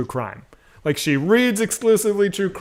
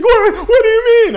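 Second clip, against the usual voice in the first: high, loud mock panic; retold panicked wife; 7 dB louder than usual. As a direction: imitate a wife yelping in alarm. Retold frightened wife reacting to prank.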